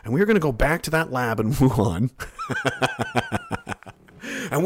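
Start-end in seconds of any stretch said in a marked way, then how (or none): 2.2-3.9 high-pitched laugh. Real, not acted.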